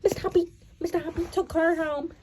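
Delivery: ordinary delivery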